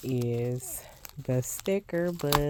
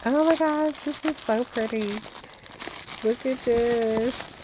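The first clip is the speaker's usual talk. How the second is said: excited squeal; 10 semitones higher than usual. Real, not acted. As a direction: gush over the pretty box. Gushing over the unboxed box.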